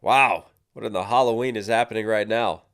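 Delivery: normal talking voice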